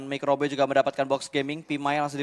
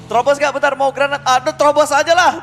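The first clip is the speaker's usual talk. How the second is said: high loud panicky team chat; player comms imitation; 10 semitones higher, 9 dB louder than usual. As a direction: act out frantic in-game comms. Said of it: "Itu komunikasinya". (meniru komunikasi pemain panik)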